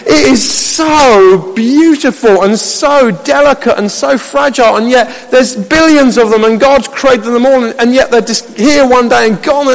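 high, marvelling delight; worshipful wonder. Acted. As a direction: draw out each 'so' in awe. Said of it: "worship would just come out of my heart".

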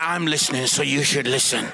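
low, deadpan divine aside; God's voice. Acted. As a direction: advise him drily in a low register. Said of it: "God was like".

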